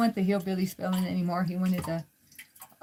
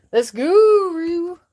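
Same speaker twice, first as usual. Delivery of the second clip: high, loud, slow cheer for the dog; excited cheer; 9 semitones higher, 10 dB louder, much slower than usual. Real, not acted. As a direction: urge the dog on with a loud, high, drawn-out call.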